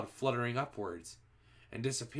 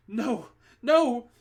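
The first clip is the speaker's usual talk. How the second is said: much higher, louder desperate no; terrified begging; 12 semitones higher, 10 dB louder than usual. A narrator's reading. Front-row witch begging for her life, high loud desperate cry. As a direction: scream for mercy. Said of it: "begged the witch".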